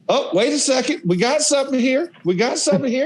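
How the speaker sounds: high, sarcastic coach voice; impression of Coach Spurrier. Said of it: "he goes".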